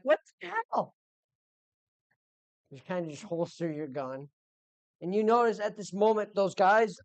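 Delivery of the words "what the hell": high, startled what the hell; a sudden high baffled outburst; confused party member. Retold or imitated. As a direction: squeak it out in disbelief. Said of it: "you kinda look at Ralph like".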